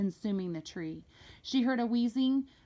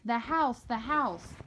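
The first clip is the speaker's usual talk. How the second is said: mock shout; 4 semitones higher, slower than usual. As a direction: call out about the house, mildly alarmed. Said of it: "shouting something that sounded like".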